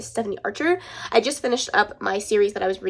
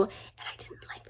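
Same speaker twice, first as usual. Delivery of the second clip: whispered; 18 dB quieter, slower than usual. Real, not acted. Confessing dislike quietly.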